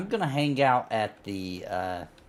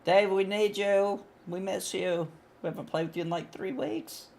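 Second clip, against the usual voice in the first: pleading; 8 semitones higher, 4 dB quieter than usual. Pretend. Guilt-tripping absent friend.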